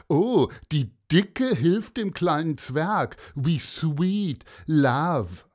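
loud sarcastic taunt; fake-sweet sneer at her; loud mock sweetness; mocking bully. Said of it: "rief Jeff".

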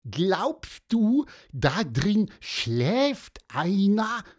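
hohe, langsame Vogelstimme; kleiner Vogel. Acted. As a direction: neugierig und hoch als kleiner Vogel fragen. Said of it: "fragte Pieper". (Pieper fragt Antonius, hohe Vogelstimme)

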